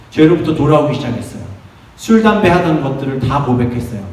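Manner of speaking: ordinary talk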